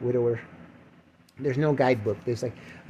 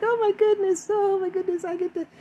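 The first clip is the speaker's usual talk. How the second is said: very high squealy mock bride voice; excited bride squeal. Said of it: "the woman's like".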